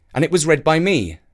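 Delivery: ordinary delivery, nothing marked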